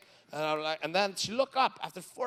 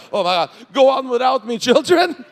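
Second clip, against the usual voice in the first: loud, high melodramatic wail; dramatic wife; 6 semitones higher, 12 dB louder than usual. Retold or imitated. Comedian imitating his wife leaving the ride, hammed-up loud. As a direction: play the dying heroine, hammed-up. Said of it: "she's like".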